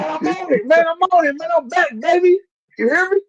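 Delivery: high, excited hype-man shout; hyped up. Real, not acted. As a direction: celebrate being back, voice way up. Hyped return to show.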